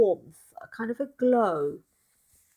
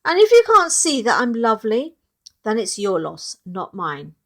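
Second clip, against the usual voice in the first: bold declaration; 8 dB louder than usual. Acted. Ruby's defiant speech continues.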